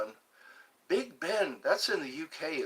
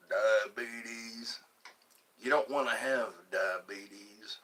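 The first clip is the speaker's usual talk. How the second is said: low, drawn-out Southern drawl; low southern accent; 4 semitones lower than usual. Pretend. Streamer performing a viewer's request, low southern drawl. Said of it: "That's a low southern accent".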